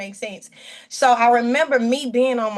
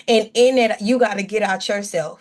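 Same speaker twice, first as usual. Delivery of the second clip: ordinary delivery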